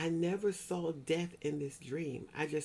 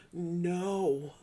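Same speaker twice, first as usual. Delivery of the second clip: normal talking voice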